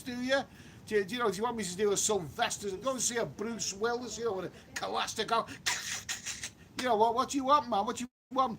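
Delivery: loud, high, indignant retort building to a shout; exasperated rant. Real, not acted. Streamer snapping back at a viewer who called him all talk, exasperated shout. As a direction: fire back at the heckler, fed up.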